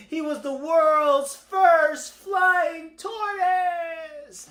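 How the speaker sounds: high, loud, long-stretched words; triumphant announcement. A narrator's reading. Storyteller announces tortoise's first flight, triumphant shout. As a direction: proclaim it hugely, drawing out every word. Narrator's grand climax of the flight.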